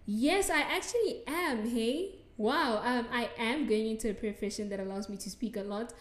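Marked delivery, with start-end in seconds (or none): none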